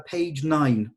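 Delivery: ordinary delivery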